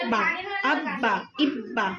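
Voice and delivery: "अब बा" with the b held double; doubled b. Shown as a demonstration. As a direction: press the b twice in "इब बा". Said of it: "दो मरतबा पढ़ना पड़ेगा".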